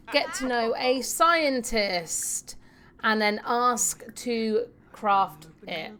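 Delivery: ordinary delivery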